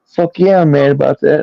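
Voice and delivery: hammed-up imitation of her outrage; retelling his ex-wife's fury over the election; mocking ex-wife. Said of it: "she's like".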